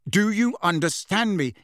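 insistent snarled demand; snarling. A narrator's reading. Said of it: "Harry snarled".

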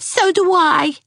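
put-on high, keen little girl; a child eager to agree; high, eager girl's voice. A narrator's reading. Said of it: "said Geraldine".

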